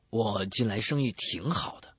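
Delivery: ordinary talk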